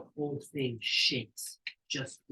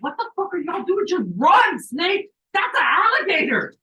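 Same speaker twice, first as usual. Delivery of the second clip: much higher, loud shout at the snake; panicked shout; 13 semitones higher, 9 dB louder than usual. Retold or imitated. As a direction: scream a warning at the snake. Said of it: "But I'm like".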